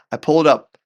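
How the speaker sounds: ordinary talk, nothing marked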